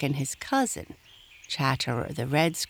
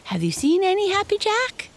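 high, loud chipmunk question; teasing chipmunk; 5 dB louder, faster than usual. A narrator's reading. Striped Chipmunk slyly quizzing Happy Jack, teasing high voice. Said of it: "his bright eyes twinkled".

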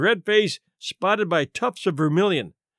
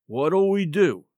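usual speaking voice

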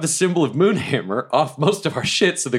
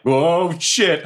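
alarmed; 5 semitones higher than usual. Retold or imitated. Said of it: "And I'm like".

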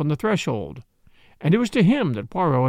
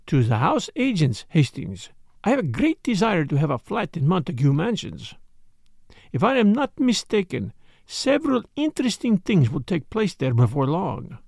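Poirot, self-assured. Acted. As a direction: announce your scheme in a French accent.

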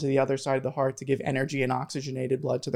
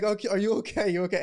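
a touch higher, lightly imitating a worried caller; mildly voiced concerned dean; 6 semitones higher, faster than usual. Admissions dean asking the screaming student if he's okay, lightly mimicked concern. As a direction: check on him, a little concerned.

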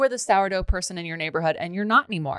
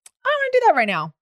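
put-on high, louder childish whine; five-year-old kid; 14 semitones higher, 5 dB louder than usual. A five-year-old refusing, high loud childish whine. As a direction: whine it like a stubborn little kid. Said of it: "a five-year-old who's like".

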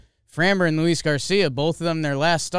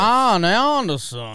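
slow, drawn-out Southern accent, pitched up and louder; old Southern accent; 5 semitones higher, 4 dB louder, slower than usual. Shown as a demonstration. Co-host asks him to say the pitcher's name, slow Southern accent. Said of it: "Try to say Ian Anderson in that voice".

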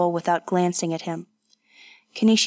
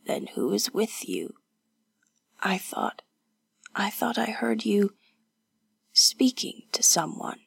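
soft, halting near-whisper; weak, confused whisper; 5 dB quieter than usual. Acted. Wounded woman asking who is there, weak whisper. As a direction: ask it faintly, pausing for strength.

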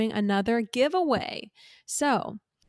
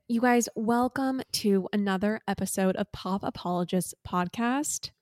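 usual speaking voice

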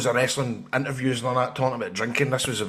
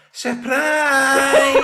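high, drawn-out holler of surprise; surprise greeting; 12 semitones higher, 8 dB louder than usual. Retold or imitated. Niece and dad burst in to surprise him, excited holler. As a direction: belt out a long, high family greeting. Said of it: "to surprise me on Facebook".

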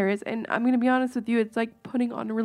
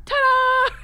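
louder and higher showman's ta-da; 5 dB louder than usual. Retold or imitated. Dad's last joke, biggest showman's ta-da. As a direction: top it off like a grand finale. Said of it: "He's like".

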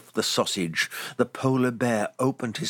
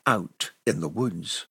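ordinary delivery, nothing marked

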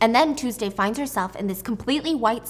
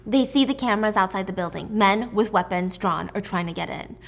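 normal talking voice

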